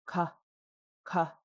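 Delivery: "ka" low, with a light puff of air. Shown as a demonstration. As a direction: drop "ka" low, K moderately aspirated. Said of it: "it's low tone".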